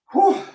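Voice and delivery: sharp high puff of breath; breathless whew. Real, not acted. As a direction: blow out a winded whew. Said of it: "I'm out of breath".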